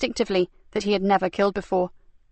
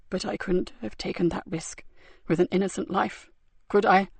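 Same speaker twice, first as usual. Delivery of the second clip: weak and wounded; 5 dB quieter than usual. A narrator's reading. Wounded man, very soft.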